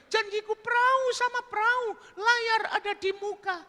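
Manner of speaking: very high falsetto coaxing; coaxing woman. Acted. Woman coaxing Burhan to remember her, high falsetto. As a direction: coax him in high falsetto.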